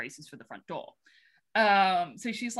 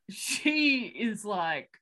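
ordinary delivery

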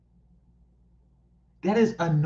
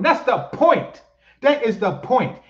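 high, raised-voice insistence; exasperated insistence; 7 semitones higher, 4 dB louder than usual. Real, not acted. Commentator rebutting a complaint about forgetting origins, high exasperated insistence. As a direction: drive it home, exasperated and high.

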